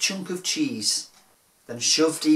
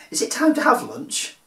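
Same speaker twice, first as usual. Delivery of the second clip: hungry dinosaur; 10 semitones higher, 5 dB louder than usual. Acted. Hungry dinosaur asks about lunch.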